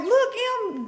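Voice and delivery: high whiny mock voice; imitating a client. A client showing scratches to Em, high whiny. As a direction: complain in a high pitch. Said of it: "Be like".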